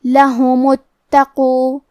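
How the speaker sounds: لہم اتقو joined straight into the ت, hamza dropped, very slow; slow linked لہم اتقو; linking demo. Shown as a demonstration. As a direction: slide لہم into اتقو without a glottal stop. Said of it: "لہم کے بعد حرف التا کو پڑھیں گے".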